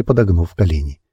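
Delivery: usual speaking voice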